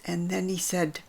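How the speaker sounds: ordinary delivery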